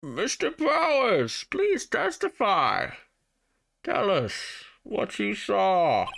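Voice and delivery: put-on high, slow old judge. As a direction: stretch it out high and doddery. Judge character voice.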